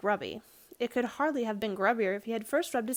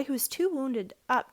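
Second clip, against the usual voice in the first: usual speaking voice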